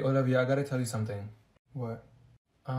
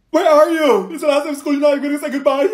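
high, loud tearful complaint; hammed-up crying; 8 semitones higher, 7 dB louder, faster than usual. Student on last day of school, hammed-up tearful wail. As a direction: wail it as an overdone farewell. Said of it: "smiling and then crying".

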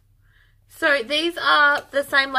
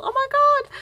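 excited squeal; 13 semitones higher, 4 dB louder than usual. Real, not acted. Excited over pink yarn.

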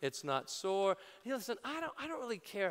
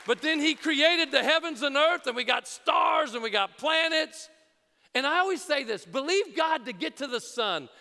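preaching at full force; 2 semitones higher, 8 dB louder than usual. Real, not acted. Pastor fires up the congregation, loud preaching. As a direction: build the crowd up with big shouted lines.

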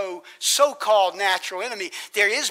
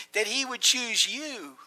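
normal talking voice

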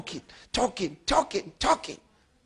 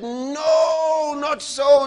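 high, loud commanding shout; 9 semitones higher, 7 dB louder, much slower than usual. Retold or imitated. Father commanding daughter's bent legs, loud shout. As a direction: order her legs with a shout. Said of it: "I shouted".

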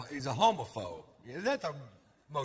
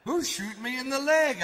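higher, louder, not quiet at all; an ironic "quiet" wonder, loud and higher; mock quiet wonder; 9 semitones higher, 6 dB louder than usual. A man shot in the leg wonders aloud, loud and higher. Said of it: "I wonder quietly to myself".

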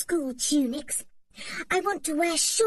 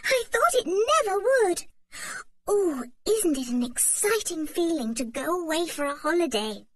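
breathless joy; 9 semitones higher than usual. A narrator's reading. Anne greets the holiday morning, trembling joy. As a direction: savour the moment.

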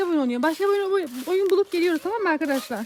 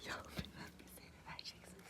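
whispering while hiding; 28 dB quieter, faster than usual. Real, not acted.

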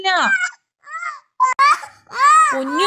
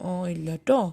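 much softer, fading into a whisper; hushed reassurance; 11 semitones lower, 11 dB quieter than usual. Real, not acted. Mother soothing hurt baby.

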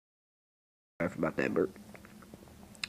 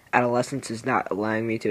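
normal talking voice

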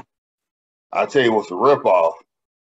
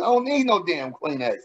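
ordinary talk, nothing marked

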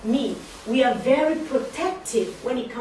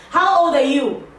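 nosy question; 3 semitones higher, 7 dB louder than usual. Retold or imitated. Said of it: "no one said".

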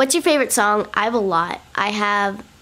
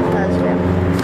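pitch; slower than usual. Real, not acted. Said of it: "oh wow".

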